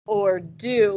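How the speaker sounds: ordinary delivery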